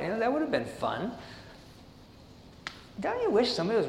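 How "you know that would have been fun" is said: ordinary talk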